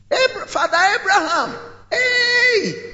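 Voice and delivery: high-pitched cry to Father Abraham from far off; desperate calling out. Acted. Rich man in torment calling to Abraham, high desperate cry. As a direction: call across a great distance, pleading.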